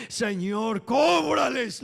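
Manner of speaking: loud, high mock cry for vengeance; hammed-up demand for retribution; vengeful plea. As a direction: call on God to make them pay, voice rising high. Acts prayer Esteban did not pray.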